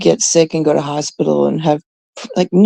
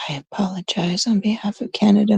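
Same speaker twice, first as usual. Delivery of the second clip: mock solemn whisper; hushed mock apology for a whole country; 7 dB quieter, faster than usual. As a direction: whisper it like a guilty confession.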